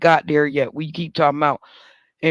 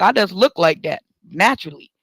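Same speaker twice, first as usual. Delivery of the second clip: ordinary talk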